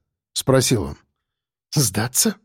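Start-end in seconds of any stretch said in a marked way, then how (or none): 1.7-2.4 lower, softer scoffing question; snorting. A narrator's reading. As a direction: toss the word back with a scoff. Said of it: "фыркнула она".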